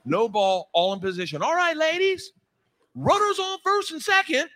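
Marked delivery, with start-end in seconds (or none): 1.4-4.5 high, chirpy imitation of the coach calling to the girls; hammed-up cheerful coach; mock coach voice. Coach rallying the team, high and chirpy.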